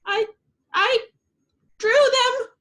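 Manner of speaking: put-on high, tremulous little-girl stammer; shaky, scared. A narrator's reading. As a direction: stammer it out, frightened. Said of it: "Bubbles said, shaking".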